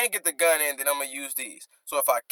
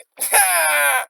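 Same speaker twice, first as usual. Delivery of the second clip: laugh; 11 semitones higher, 3 dB louder than usual. Real, not acted. Laughing at the bar.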